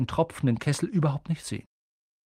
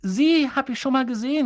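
put-on much higher and louder, boy calling out in recognition; Harry ruft; 10 semitones higher, 4 dB louder than usual. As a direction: call out, recognising him. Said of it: "rief Harry". (Harry, hoch und laut rufend)

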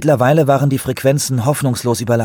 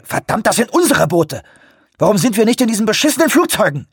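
high, furious cursing; frantic, raised-voice rant; angry outburst; 12 semitones higher, 3 dB louder than usual. A narrator's reading. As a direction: explode in frustration at your colleague. Frantic skipper quarrels over boats.

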